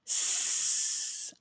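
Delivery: long unvoiced hiss on S; drawn-out s like a snake. Shown as a demonstration. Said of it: "We'll make our S sound just like a snake".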